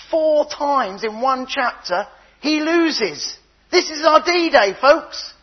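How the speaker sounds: high, loud, rousing preacher's call; rally the congregation, building to D-Day; full-force preaching. Acted.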